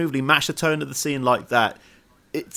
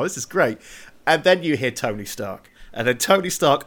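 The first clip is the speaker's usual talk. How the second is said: ordinary talk, nothing marked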